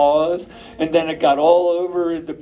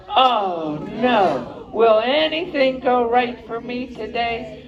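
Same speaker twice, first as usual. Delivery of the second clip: high, slow, hammed-up whine of bad luck; woeful lament; 6 semitones higher, slower than usual. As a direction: moan it like a sorry little cub. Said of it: "So he just had to say".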